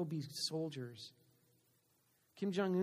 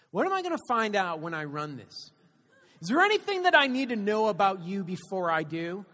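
loud, higher suspicious cop; imitated cop interrogation; 3 semitones higher, 12 dB louder than usual. Cop grilling the driver before running his license, loud and suspicious. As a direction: press him hard like a suspicious cop.

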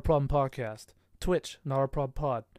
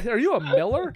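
laughing through the question, higher; laughing joke; 8 semitones higher, 6 dB louder than usual. Real, not acted. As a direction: giggle the question out.

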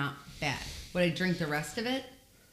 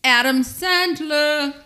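swooning; 10 semitones higher, 11 dB louder, much slower than usual. Real, not acted. Dreamy answer, stretched out.

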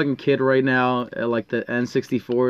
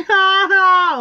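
very high-pitched mock guffaw; imitated loud laugh; 4 dB louder than usual. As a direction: imitate an obnoxious woman's piercing laugh. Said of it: "Her laugh was just like".

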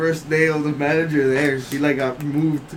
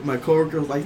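normal talking voice

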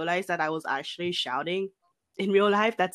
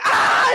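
sudden loud, high-pitched yell; imitated sleep shout; 9 dB louder than usual. Sleeper startling the roommate awake, loud imitated shout. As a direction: mimic shouting out in your sleep. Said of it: "like, shouting really loud".

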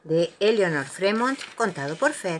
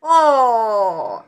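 loud, long, higher "oh"; startled exclamation; 4 semitones higher, 9 dB louder than usual. A narrator's reading. Tiger tangled in the hanging washing, startled. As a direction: let out a big dismayed oh. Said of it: "exclamó Tiger".

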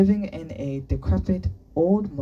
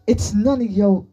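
loud, high brush-off; angry retort; 7 semitones higher, 8 dB louder than usual. A narrator's reading. Boy refusing to explain to Sunny, angrily. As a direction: snap back and break off.

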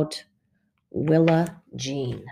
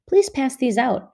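higher, brisk teacher instruction; teacher directs Ramona; directing; 8 semitones higher than usual. Acted. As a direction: hand out a task to a pupil. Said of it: "she directed".

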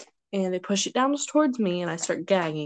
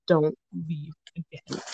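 slow, lower retold plea; mock plea; 4 semitones lower, slower than usual. Friends to the returning friend, slow low mock plea. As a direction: draw the words out, pitched down.

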